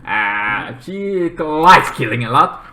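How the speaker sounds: usual speaking voice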